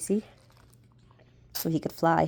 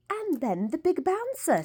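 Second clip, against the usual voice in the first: queen's voice; 10 semitones higher, 7 dB quieter than usual. A narrator's reading. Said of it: "said the queen".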